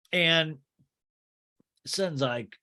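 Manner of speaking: ordinary delivery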